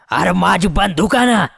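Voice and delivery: acted shout without extra volume; headman shouting. A narrator's reading. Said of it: "Chandru Patil ओरडत होता".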